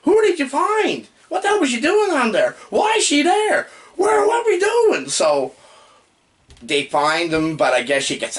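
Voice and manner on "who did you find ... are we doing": high, loud confused shouting; baffled yelling. Retold or imitated. Host yelling at the confusing movie, high and loud. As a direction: yell your confusion at the screen. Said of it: "I'm like".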